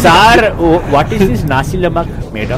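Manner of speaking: hammed-up Indian accent; comic Indian accent with an emphatic opening. Shown as a demonstration. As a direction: call for attention in an Indian accent, then ask. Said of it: "that sounds like you".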